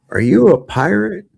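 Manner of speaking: high, loud, astonished question; incredulous question. Acted. Tavern guy questioning the man with a hook, incredulous. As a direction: ask it in amazed disbelief. Joke character questions the pirate.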